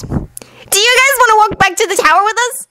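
put-on very high-pitched boyish invitation; eager Naruto. Naruto invites them along, high and eager. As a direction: pipe up hopefully.

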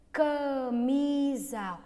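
Camisa very slowly, syllable by syllable; slow demo word. Shown as a demonstration. Teacher drawing out Camisa to show the K. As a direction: stretch Camisa so the first sound shows. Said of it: "K, começa com K".

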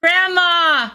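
high, drawn-out holler; calling out. Pretend. Woman summoning her pretend grandma to the phone, loud call. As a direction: yell for someone in another room.

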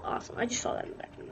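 whisper. Real, not acted. Gamer whispering about the background, whisper. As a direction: whisper it to yourself. Quiet unvoiced comments.

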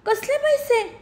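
put-on high, startled little girl's question; scared girl. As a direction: ask it like a frightened child. Frightened girl replies.

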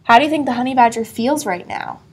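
usual speaking voice

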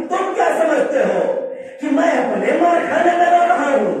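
high-pitched mock-tearful protest; crying protest. Weeping Bahlol answers the king, acted high-pitched protest. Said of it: "जनाब बहलोल रोते रहे".

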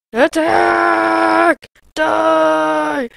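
loud, very slow drawn-out war cry; rally for a last charge; battle charge. Pretend. Charging out to fight.

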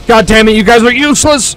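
high, loud exasperated outburst; frustrated yell. Real, not acted. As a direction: blow up at useless teammates. Teammates failing in dragon fight.

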